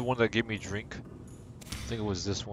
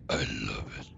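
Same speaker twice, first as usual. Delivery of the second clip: very low, mock-deep voice; playful bass-voiced echo; deep put-on voice; 12 semitones lower, 5 dB quieter than usual. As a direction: drop right down low and softer for a playful echo. Repeats it in a put-on voice.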